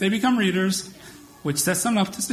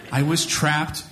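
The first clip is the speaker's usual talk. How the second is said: ordinary talk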